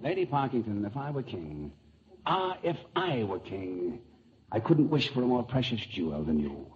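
English accent. Shown as a demonstration. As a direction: declaim love in a lofty English accent. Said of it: "This is English. Look, I'll show you".

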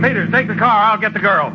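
high urgent commands; shouting orders. Acted. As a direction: bark orders in a crisis. Shouting orders during attack.

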